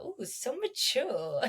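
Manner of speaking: slow, soft, teasing awe; mock impressed. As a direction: coo it with fake admiration. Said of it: "I'm like".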